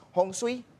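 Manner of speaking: "Hong Sui" said as hong-swee, rising at the end; Hokkien pronunciation. Shown as a demonstration. Master explaining Hokkien, "Hong Sui" as hong-swee. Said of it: "Ah, pagka Hokkien".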